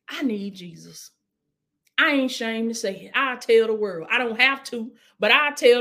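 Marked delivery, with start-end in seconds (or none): 0.1-1.1 breathy whisper of need; hushed plea to Jesus; whispered prayer. Shown as a demonstration.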